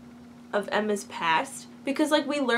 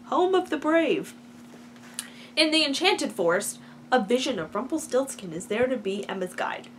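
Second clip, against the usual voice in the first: normal talking voice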